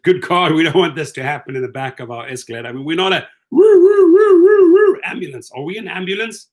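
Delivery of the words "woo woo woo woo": high, loud siren wail woo woo; playful siren noise; siren imitation. Mimicking an ambulance siren.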